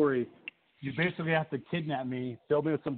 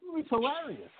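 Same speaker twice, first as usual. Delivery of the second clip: mock reply; 11 semitones higher, 3 dB quieter than usual. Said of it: "I was like".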